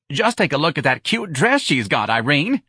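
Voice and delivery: high-pitched, animated admiring; happy exclamation. Acted. Santa pointing out a dress at the movie, delighted. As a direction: gush excitedly to a friend. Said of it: "Santa's happy exclamations".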